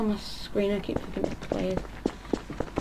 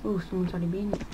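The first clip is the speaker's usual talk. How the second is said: ordinary delivery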